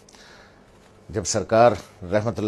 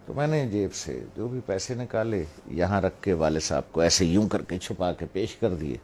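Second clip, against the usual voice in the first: usual speaking voice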